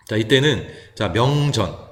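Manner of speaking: ordinary delivery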